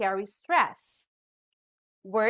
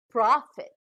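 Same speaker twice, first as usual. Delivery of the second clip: "profit" with PROF stronger than the ending; "profit" demonstrated with emphasis on PROF; stress on first syllable; 3 dB louder than usual. Shown as a demonstration. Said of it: "stress is given on the first syllable".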